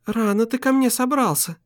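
high, gentle female voice; smiling woman's voice. Acted. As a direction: smile through the words. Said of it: "улыбнулась она".

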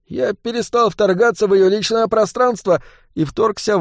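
high-pitched hurried patter; rattled-off patter. A narrator's reading. Boy finishing absurd excuse to thugs, rattling it off. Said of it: "скороговоркой закончил Жига".

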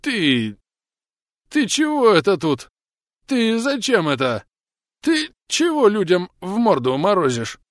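put-on slurring drunk. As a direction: slur it out, outraged and drunk. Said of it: "возмущённо начало тело заплетающимся языком".

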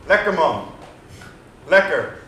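calling out. Retold or imitated. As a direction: shout it at the couple in the street. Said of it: "Ik hoor hem roepen". (luid en hoger roepen naar het zoenende stel)

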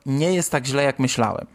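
usual speaking voice

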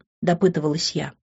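plain reading voice

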